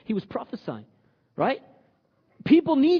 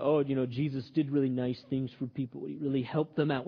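lower, softer mimicry of tame talk; mild mock niceness; 7 semitones lower, 5 dB quieter than usual. Preacher imitating how people talk about Jesus, mildly mocking. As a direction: lightly parody a bland, harmless summary.

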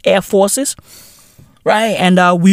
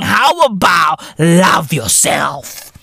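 normal talking voice

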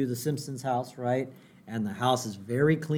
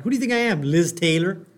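lightly acted, high-pitched protest; mock indignation; 5 semitones higher, 6 dB louder, faster than usual. Husband to wife who wants a pricey bowl, mock indignant.

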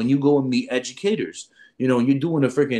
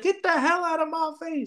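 put-on squeaky girl telling him off, pitched way up; high mock snap. A girl telling a pushy guy to back off, high mocking voice. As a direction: mimic her high, scornful brush-off.

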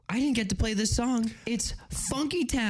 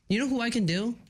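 normal talking voice

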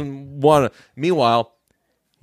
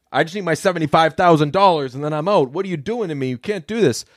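exasperated whine; 6 semitones higher, faster than usual. Retold or imitated. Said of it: "And he's like".

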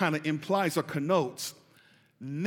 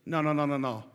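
ordinary talk, nothing marked

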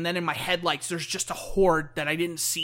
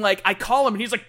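ordinary talk, nothing marked

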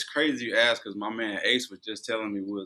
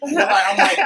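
much higher and louder, laughing through it; 13 semitones higher, 11 dB louder than usual. Real, not acted. Correcting himself to the host, laughing the words out.

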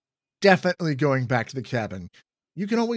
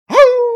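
owl hoot; 18 dB louder than usual. Acted.

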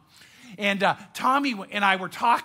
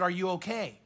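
soft, caring uncle asking quietly; gentle concern; 3 semitones lower, 7 dB quieter than usual. Shown as a demonstration. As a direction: ask softly like a worried uncle. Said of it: "did you notice the difference?".

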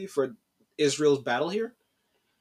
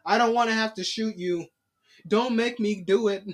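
higher-pitched, hammed-up pleading; mock reluctant villain; 5 semitones higher than usual. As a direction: imitate a gunman begging not to shoot. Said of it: "That's exactly how they sound".

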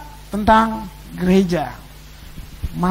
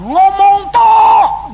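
much higher, much louder, slowly drawn-out yell; heckling shout; 11 semitones higher, 9 dB louder, much slower than usual. Retold or imitated. Preacher imitating the woman heckling Pak Arifin from the back, a slow, loud yell. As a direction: hurl it from the back of the room. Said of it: "dia teriak dari belakang".

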